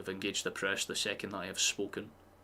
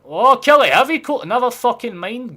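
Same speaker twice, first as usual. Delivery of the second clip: much higher, much louder cry of disbelief, then giving up; exasperated shout; 18 dB louder than usual. Real, not acted. Streamer yelling at Kelly over a false goal update, exasperated shout. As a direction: yell at the chat for the false alarm.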